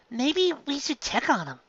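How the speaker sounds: a touch of worry, unsure; worried. Acted. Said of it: "Nami said worriedly".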